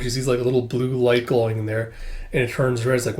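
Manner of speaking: usual speaking voice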